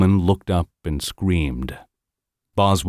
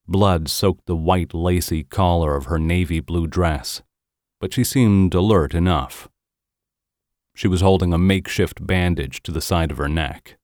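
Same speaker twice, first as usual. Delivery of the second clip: plain reading voice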